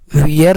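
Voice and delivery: वियर with an "ee" vowel, like "wee-er"; mispronounced "were". Shown as a demonstration. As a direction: say वियर with ee gliding into r. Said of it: "नहीं पढ़ेंगे".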